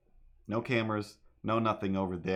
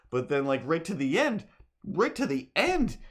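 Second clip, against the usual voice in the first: rising excitement; 7 semitones higher, 3 dB louder than usual. Retold or imitated. Building excited retelling of scene.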